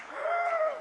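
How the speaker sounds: high sudden gasp of fright; sharp shocked gasp. Retold or imitated. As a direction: gasp like she's seen a crash coming. Girlfriend gasps in car.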